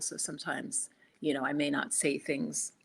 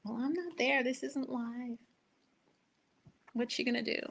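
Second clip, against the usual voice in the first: lightly voiced doubting viewer, higher pitch; imitated replay viewers; 6 semitones higher, 4 dB quieter than usual. Host mimicking replay folks who missed the live, higher mock-complaining voice. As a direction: put on a higher, softer voice for imagined viewers. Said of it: "the replay folks won't be like".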